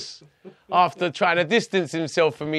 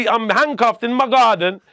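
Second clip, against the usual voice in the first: ordinary talk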